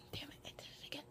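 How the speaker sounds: barely audible annoyed whisper; whispered self-scolding. Real, not acted. Vlogger catching another um, whispering in annoyance to self. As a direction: curse yourself under your breath.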